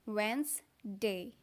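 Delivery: वेडनेसडे slowly as WENZ-day, d silent; slow Wednesday. Shown as a demonstration. Teacher slowly demos Wednesday without the d. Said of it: "डी का sound यहाँ पर गायब".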